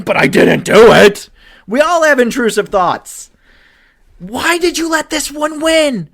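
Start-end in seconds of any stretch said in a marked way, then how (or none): 4.2-6.1 exasperated. Real, not acted. Ranting at Josh Allen.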